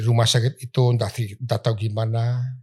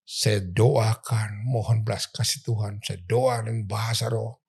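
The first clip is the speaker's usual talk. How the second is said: ordinary talk, nothing marked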